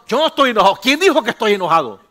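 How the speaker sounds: loud, indignant denial with a laugh; angry denial. Retold or imitated. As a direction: deny being angry while clearly furious. Congregant denies anger angrily. (negación enojada y en voz alta)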